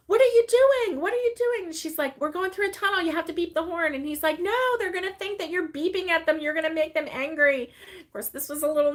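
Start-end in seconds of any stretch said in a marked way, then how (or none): 0.1-1.6 high, loud mock panic; hammed-up retold freak-out. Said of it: "like, freaking out". 2.2-3.9 high, matter-of-fact retort; lightly acted justification; playful insistence. Retells daughter explaining the honking rule. 4.4-7.7 high, worried protest; playfully retold alarm; mock alarmed objection. Retells dad's alarmed objection.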